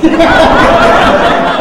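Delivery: high, loud laugh. Real, not acted. Speaker laughs at the old lady's reply. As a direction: bursts out again.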